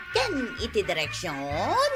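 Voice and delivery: normal talking voice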